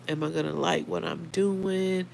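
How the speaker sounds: usual speaking voice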